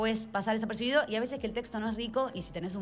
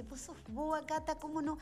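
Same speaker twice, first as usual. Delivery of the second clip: high, softer imitation of chiding; retold exasperated urging; 9 semitones higher, 7 dB quieter than usual. Director urging the hesitant actress, imitated high-pitched chiding. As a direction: imitate an incredulous friend, pitched right up.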